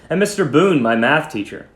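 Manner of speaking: usual speaking voice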